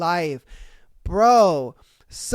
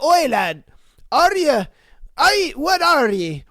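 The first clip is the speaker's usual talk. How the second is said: high, loud Scottish challenge; mock Scottish accent; 5 semitones higher, 4 dB louder than usual. Scotsman quizzing the customer's origins, pushy mock Scottish accent. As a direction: accost him in a put-on Scottish accent. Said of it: "Happened in Scotland".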